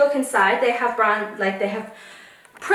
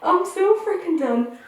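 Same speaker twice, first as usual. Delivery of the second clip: higher pitched fed-up cry; exasperated and pitched up; exasperated outburst; 7 semitones higher than usual. Real, not acted. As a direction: throw up your hands, voice up high. Repeats line, first high.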